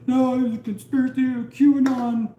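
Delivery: hammed-up imitation of someone crying conspiracy; mocking naysayer. Mimicking doubters who dismiss warnings about government, mocking and high-pitched.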